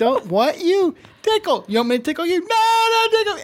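being tickled. Retold or imitated. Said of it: "she's like".

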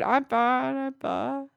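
imitating a croaky sick voice; hoarse voice. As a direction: rasp it like you've lost your voice. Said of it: "he totally lost his voice".

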